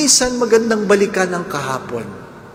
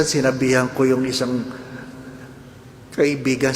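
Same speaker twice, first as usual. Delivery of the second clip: usual speaking voice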